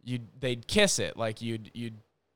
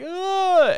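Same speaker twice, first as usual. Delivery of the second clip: mock groan; 5 dB louder than usual. Tackled girl's groan imitated.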